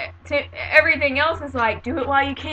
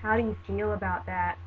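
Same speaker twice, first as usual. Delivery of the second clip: pitying dismay; 2 semitones lower, 6 dB quieter than usual. Retold or imitated. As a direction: imitate someone quietly dreading your news for you. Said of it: "say it in that tone of voice".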